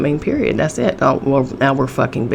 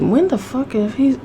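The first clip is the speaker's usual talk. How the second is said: imitating the outraged wife, pitched up; indignant wife; 6 semitones higher than usual. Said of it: "And she said".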